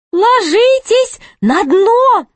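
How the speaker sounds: very high, slow, drawn-out jester voice; jester's urgent warning. A narrator's reading. Jester tells companions to hide, high urgent voice. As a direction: stretch it out in a high stage-whisper pitch, fully voiced. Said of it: "прошептал Шут своим спутникам".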